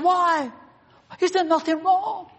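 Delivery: high, pleading protest; Pilate pleading. Acted. Pilate pleading with the crowd, high protest. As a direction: beg the crowd to reconsider.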